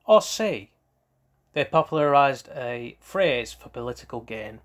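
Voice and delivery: bright quiz-host read, options spaced out; quizmaster reading. Acted. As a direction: read it like a quiz show question. Reads out quiz question and options.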